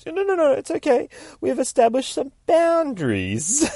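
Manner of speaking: very high falsetto backing off; high-pitched, hasty appeasing voice; placating squeal. Acted. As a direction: squeak out reassurance to a snarling animal. Deadpool backs off.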